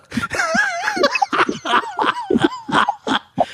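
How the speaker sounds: high, tearful laughter; real laughing fit. Real, not acted. Friend recalling who drank ammonia, laughing hard. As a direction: laugh till you nearly cry. Cracking up at the story.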